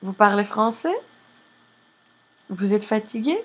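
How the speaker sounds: level start, then a sharp rise on "français" and on "fatigué"; rising question intonation. Shown as a demonstration. Teacher reviewing raising intonation, rising question ends on "français" and "fatigué". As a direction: lift the last syllable of "français" and "fatigué" as questions. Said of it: "raising intonation".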